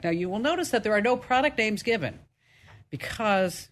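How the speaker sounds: normal talking voice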